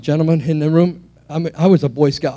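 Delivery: ordinary talk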